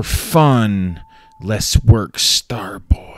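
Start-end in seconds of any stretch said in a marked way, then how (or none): 2.2-3.1 whisper. Acted. Whispered name.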